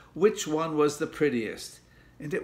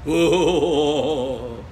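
loud, long ghostly wail; spooky wail; 3 semitones higher, 8 dB louder than usual. A narrator's reading. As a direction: wail to scare your sister. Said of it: "a really spooky wailing noise".

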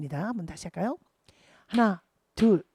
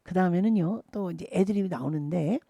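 normal talking voice

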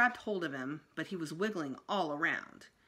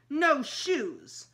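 put-on stubborn toddler; 7 semitones higher, 6 dB louder than usual. A narrator's reading. Toddler refusing new shoes.

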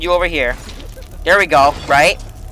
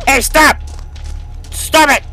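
yelling to stop; 6 semitones higher, slower than usual. Real, not acted. Yelling at a game enemy.

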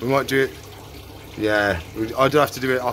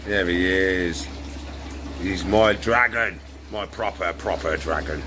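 tender; 9 semitones lower, 4 dB quieter, slower than usual. Real, not acted. Tender praise to pet.

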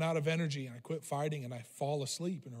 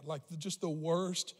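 normal talking voice

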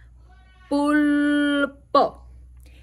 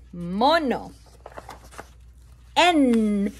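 ordinary delivery

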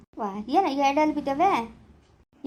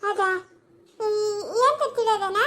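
slow, tearful, higher cry; 6 semitones higher, slower than usual. Acted. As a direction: ask through tears, slowly. Worried about bitten child.

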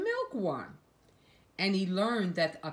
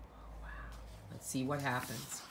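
hushed aside; 4 semitones lower, 9 dB quieter than usual. Real, not acted. Aside while reading to child.

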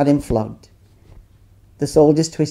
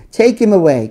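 the repeat louder and higher, pushing harder; shouted louder; 6 semitones higher, 5 dB louder than usual. A narrator's reading. Crowd repeating its demand to Pilate, louder and higher shout. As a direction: push it up as the crowd grows fiercer. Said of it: "But they shouted".

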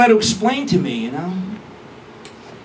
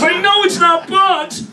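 mock whiny protest; 8 semitones higher, slower than usual. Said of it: "She goes".

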